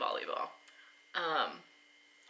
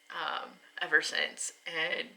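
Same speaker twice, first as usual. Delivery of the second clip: ordinary delivery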